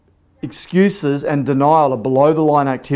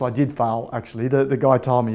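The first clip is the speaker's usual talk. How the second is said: ordinary talk, nothing marked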